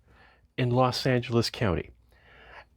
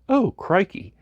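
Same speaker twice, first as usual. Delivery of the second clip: higher hammed-up Australian exclamation; a playful Australian caricature; mock Australian; 9 semitones higher than usual. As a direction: toss it off in a jokey Australian accent. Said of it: "that would be more Australian".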